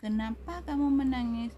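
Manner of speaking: high, loud demand; harsh scolding question. Shown as a demonstration. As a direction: snap the question at the child as a demonstration. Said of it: "beda intonasi".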